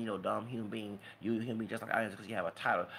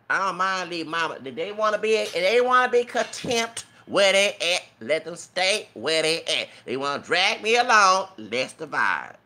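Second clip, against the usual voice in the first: loud, high, heated outburst; rising fury, shouting them down; emotional tirade, words stretched and pushed hard; angry rant; 5 semitones higher, 10 dB louder than usual. Real, not acted. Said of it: "I got all emotional".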